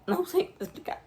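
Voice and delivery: real tears catching in the throat; fighting back tears, voice breaking; choked up. Real, not acted. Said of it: "Desculpa, gente". (emocionada, com a voz embargada)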